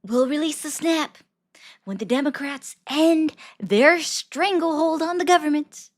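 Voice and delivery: Trump impression. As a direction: channel Trump at the podium, pushing the words up and out. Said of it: "My Trump impression is just like".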